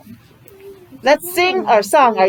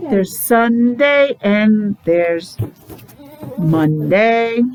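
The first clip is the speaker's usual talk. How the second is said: drawn out, each day slowly; slow it right down for the kids; much slower than usual. Shown as a demonstration. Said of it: "let's go slow".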